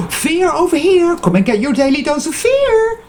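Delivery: high sing-out sales call after a gasp; mock fear hawker. Vlogger mocking clickbait videos, as a high-pitched hawker peddling fear. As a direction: call out like a hammed-up street seller.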